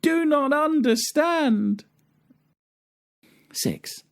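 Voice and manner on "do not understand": slow, extremely high wail; drawn-out squeal of indignant dismay; hammed-up storybook wife wailing in high falsetto; crying out. A narrator's reading. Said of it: "she cried with spoon in hand".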